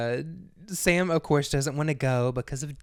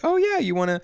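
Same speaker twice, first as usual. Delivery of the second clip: excited Sam; 14 semitones higher, 8 dB louder, faster than usual. Retold or imitated. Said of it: "And she's like".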